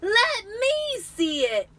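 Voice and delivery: whine-yell a spoiled demand. Acted. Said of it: "demanded Dudley".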